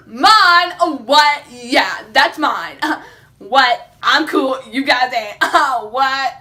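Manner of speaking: loud, hammed-up swagger; mock-tough boasting with laughs; cocky bragging. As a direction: show off loudly to everyone passing. Acted boasting to passers-by.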